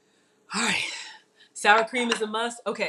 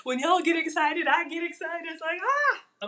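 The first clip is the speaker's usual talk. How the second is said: high, bubbly excitement; excited; 8 semitones higher than usual. Real, not acted. Cook tells live viewers she's excited, high and bubbly. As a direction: let the thrill lift your voice. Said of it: "I'm getting excited".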